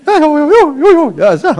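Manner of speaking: hammed-up high-pitched cooing; high put-on voice. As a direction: parody a sugary gushing voice. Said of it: "so eine höher gelagerte Stimme".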